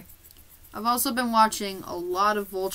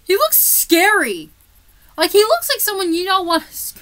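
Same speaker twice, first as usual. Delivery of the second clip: alarmed; 10 semitones higher, 9 dB louder than usual. Real, not acted. Reacting to painted face.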